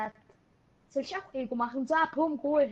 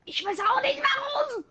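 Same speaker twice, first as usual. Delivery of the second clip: mock whining; 12 semitones higher, 5 dB louder than usual. Mock-whining before laughing.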